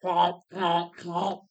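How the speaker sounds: snorting womp like a pig, fading; pig grunting womps. A narrator's reading. Pig rooting in the ground, calling womp. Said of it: "while they are saying".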